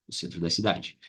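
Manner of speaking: ordinary talk, nothing marked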